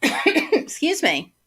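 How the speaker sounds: usual speaking voice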